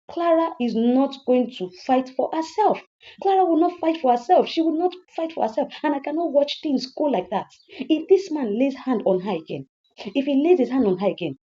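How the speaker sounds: high-pitched, emphatic protest; worked-up protective outburst; indignant insistence. Acted. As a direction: insist heatedly on defending her. Vows to fight for Clara.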